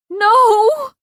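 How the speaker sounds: very high loud no; piercing cry; anguished scream. A narrator's reading. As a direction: scream in grief. Kai dies.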